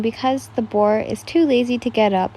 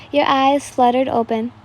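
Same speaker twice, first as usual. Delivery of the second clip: ordinary delivery, nothing marked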